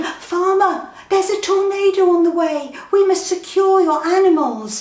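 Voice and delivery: high, loud urgent call. Acted. Narrator calling out to the farmer, high and loud. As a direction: shout the warning across the hill. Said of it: "I called out to him".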